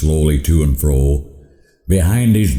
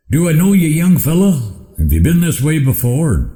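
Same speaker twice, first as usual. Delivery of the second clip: put-on a higher old rancher voice; old man voice; 10 semitones higher than usual. A narrator's reading. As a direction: lightly voice the old man, pitched up.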